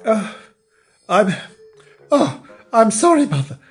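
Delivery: high, halting, stammered apology; dazed and sheepish; flustered apology. Acted. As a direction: stumble through an apology to mother.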